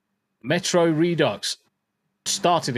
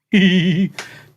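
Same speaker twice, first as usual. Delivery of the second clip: loud laugh; 5 semitones higher, 8 dB louder than usual. Real, not acted. Laughs at own joke.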